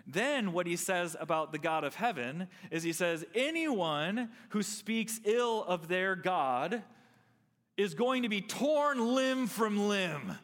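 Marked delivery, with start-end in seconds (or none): 3.4-10.4 slow, high-pitched proclamation of a threat; king's threatening decree. Acted. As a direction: play a wrathful king decreeing punishment, drawing the words out. Preacher acts out the king's threatening decree.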